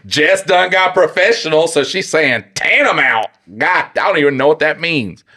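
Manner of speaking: loud, high, slow sassy voice; mock her fancy word with sass.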